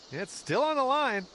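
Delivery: high, rising putt call; will the ball into the hole; excited. Real, not acted. Watching the putt drop.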